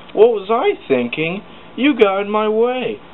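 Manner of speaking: high, loud snapping retort. Acted. James blaming Thomas after the crash, snapping high and loud. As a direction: throw the blame back hard. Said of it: "snapped James".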